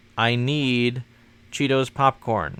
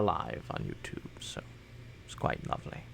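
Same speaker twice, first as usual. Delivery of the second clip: soft whispered aside; 8 semitones lower, 13 dB quieter than usual. Real, not acted. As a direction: whisper it under your breath.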